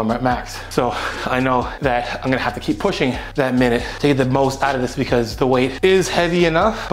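ordinary talk, nothing marked

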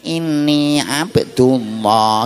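very slow, drawn-out chanted words; mock recitation with long held syllables; talking newborn. Four-day-old baby suddenly speaks in mother's arms, slow mock chant. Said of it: "bayine wong kelet umur patang dina digendong mara muni".

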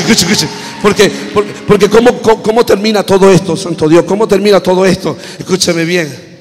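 loud, driving preacher cry; full-force preaching. Acted. Preacher shouting to his congregation, full force.

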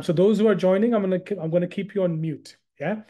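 ordinary delivery

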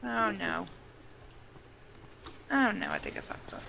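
whispered oh no; hushed dismay at a blunder; worried whisper. Real, not acted. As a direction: breathe it out, realizing a mistake.

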